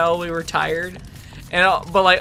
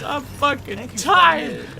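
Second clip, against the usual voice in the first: slow, higher, worn-out whine; exhausted groan; 9 semitones higher, much slower than usual. Retold or imitated. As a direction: drag out the tired complaint. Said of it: "he just goes".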